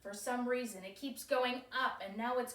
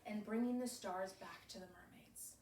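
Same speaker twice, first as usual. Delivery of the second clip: whispering; 3 semitones lower, 11 dB quieter than usual. Retold or imitated.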